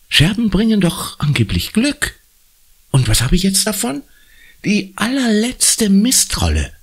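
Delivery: put-on high girlish voice, grumbling; disgruntled schoolgirl Conny. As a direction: complain in a high child's voice. (hohe, mürrische Mädchenstimme)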